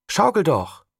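put-on mild girl's call. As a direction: shout it from the swing. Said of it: "rief sie".

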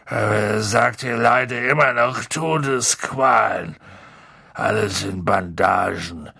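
tipsy big-man moaning; drunk, miserable Hagrid. Acted. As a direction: slur it out gloomily, dragging the last words.

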